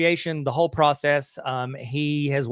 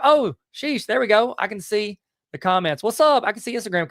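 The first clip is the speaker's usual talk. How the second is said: surprised; 7 semitones higher, 3 dB louder than usual. Real, not acted. Sees Instagram comments appear.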